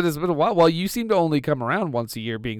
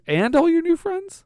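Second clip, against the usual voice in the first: incredulous, much higher; surprised; 14 semitones higher than usual. Real, not acted. Host echoing Santa in shocked disbelief. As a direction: repeat it back in shocked disbelief.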